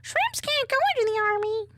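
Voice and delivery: very high, squeaky little shrimp voice; high-pitched squeaky protest. Pretend. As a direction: squeal it like a tiny cartoon shrimp. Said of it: "I'm a little shrimp".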